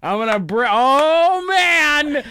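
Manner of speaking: loud, high rant peaking in a drawn-out wail; hammed-up incredulous outburst; exasperated disbelief.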